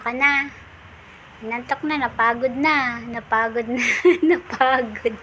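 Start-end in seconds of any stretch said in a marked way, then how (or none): 4.1-5.1 slow words through a laugh; amused owner laughing at tired puppy. Real, not acted. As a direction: laugh out the word as you say it.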